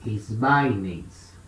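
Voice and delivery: "Esbaines" dito devagar, tônica em "bai", voz mais grave; pronúncia grega modelo. Shown as a demonstration. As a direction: pronuncie "Esbaines" com clareza de professor, tom baixo. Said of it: "Aí sim, juntei tudo". (professor demonstra a forma grega "Esbaines" aos alunos, pausado e grave)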